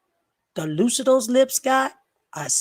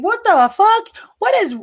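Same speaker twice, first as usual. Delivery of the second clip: high, loud What the fuck; outraged shout; 10 semitones higher, 4 dB louder than usual. Real, not acted. Streamer reacting to a misquote: high outraged shout. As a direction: explode in disbelief.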